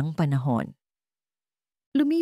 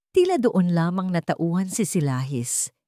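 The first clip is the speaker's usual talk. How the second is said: usual speaking voice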